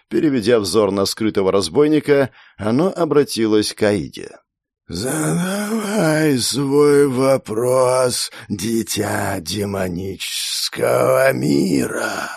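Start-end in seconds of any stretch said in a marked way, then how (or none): 4.9-12.3 slow, whistling, slightly creaky tree voice; like wind lost in a dense forest. A narrator's reading. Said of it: "Его голос был свистящим и слегка скрипучим".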